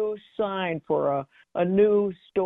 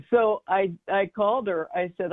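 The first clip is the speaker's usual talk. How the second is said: usual speaking voice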